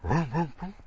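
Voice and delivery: playful engine vroom; engine revving imitation. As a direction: rev it like an old Ford. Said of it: "And he's going".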